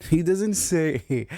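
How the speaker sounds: words squeezed out through laughter; can't stop laughing while telling it. Real, not acted. Laughing as he tells listeners about his friend.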